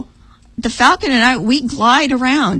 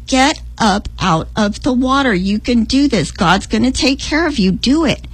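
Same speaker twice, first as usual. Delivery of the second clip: normal talking voice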